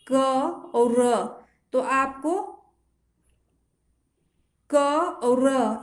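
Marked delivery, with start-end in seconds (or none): none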